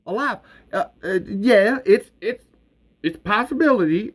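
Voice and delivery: high, hurried stammering cover; smooth it over, flustered; nervous stammer. Acted.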